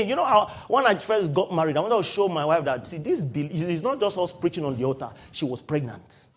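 normal talking voice

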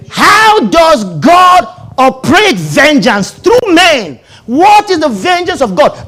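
high, loud, slow preaching cry; shouted sermon question. Real, not acted. Preacher shouting to congregation, loud and high. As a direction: call it out over the crowd.

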